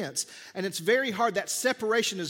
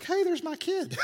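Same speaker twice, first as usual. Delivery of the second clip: much higher, bright surprised greeting; cheerful new dad; 11 semitones higher than usual. Retold or imitated. New dad greeting baby, high mock cheer. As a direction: pop up high like a delighted dad. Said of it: "it's like".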